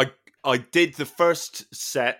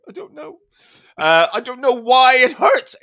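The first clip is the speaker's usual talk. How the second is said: high, strained, helpless wail; pained whine; 13 semitones higher, 9 dB louder than usual. Retold or imitated. Telling the laughing trainer he can't go on, high pained whine. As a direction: whimper the excuse in a squeaky pitch. Retelling his answer to trainer.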